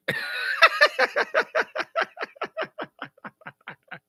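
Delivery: very high squealing laugh. Real, not acted. Host laughing as players guess.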